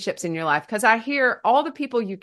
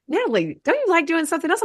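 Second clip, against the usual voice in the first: higher, quicker questioning voice; lightly imitated younger sister; 10 semitones higher than usual. Younger sister questions her sibling's love of work, lightly imitated. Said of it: "My youngest sister, Emily, will say".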